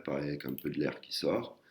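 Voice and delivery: ordinary talk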